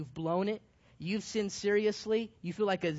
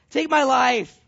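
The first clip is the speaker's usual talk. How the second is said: louder dramatic wail of "Take my life"; hammed-up plea; 3 semitones higher, 10 dB louder, slower than usual. Elijah pleads with God for death, loud and hammed-up.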